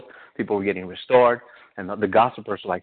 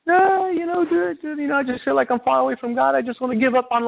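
much higher, pleading tearful voice; crying young woman; 14 semitones higher than usual. Retold or imitated. Young woman confessing she feels far from God, high tearful voice. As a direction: play a distraught young woman near tears. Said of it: "and she's crying".

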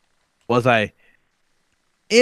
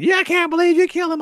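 high, whiny mock of the captive woman; mock woman's voice; 14 semitones higher, faster than usual. Mocking the hostage's complaints in a high put-on voice. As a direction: squeak it out like a nagging hostage. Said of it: "talking shit the whole time".

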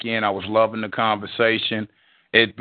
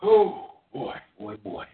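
breathless, fading sigh of words; gasping, trailing off; out of breath; slower than usual. Real, not acted. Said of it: "Let me catch my breath".

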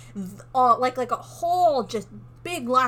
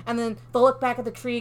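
ordinary delivery

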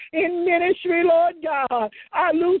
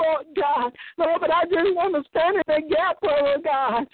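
ordinary delivery

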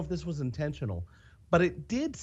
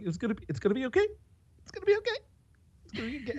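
soothing reassurance; 8 semitones higher, 4 dB quieter, much faster than usual. Retold or imitated. Retold comforting someone.

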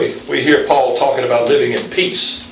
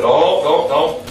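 put-on old men's warning; slower than usual. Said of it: "the old guys telling me".

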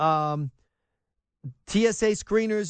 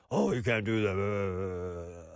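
much lower, softer, slow prissy scolding; mock whiny objection; 12 semitones lower, 7 dB quieter, slower than usual. Host mocking people who object to profiling, prissy and scandalized.

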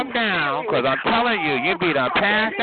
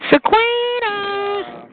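long, high drawn-out holler; calling out; 4 dB louder, much slower than usual. Real, not acted. Calling for Shaquita on the line, drawn-out high call. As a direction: stretch the name out high and slow.

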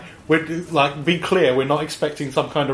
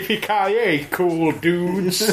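put-on American accent; 3 semitones higher, much slower than usual. Continuing the Kirsten impression.

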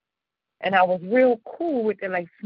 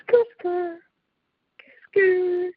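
high tire screech "skr"; car skid sound; 11 semitones higher, much slower than usual. Shown as a demonstration. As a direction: screech like skidding tires. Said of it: "make the sound effect".

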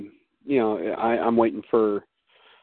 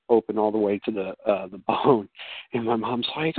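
ordinary talk, nothing marked

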